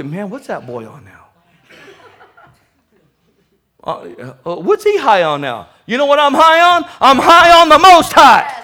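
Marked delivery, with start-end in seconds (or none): 0.0-5.7 mocking onlooker. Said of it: "you probably say".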